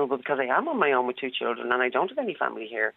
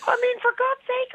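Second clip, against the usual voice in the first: much higher, exasperated. Real, not acted. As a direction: throw up your hands. Exasperated at scammer's pitch.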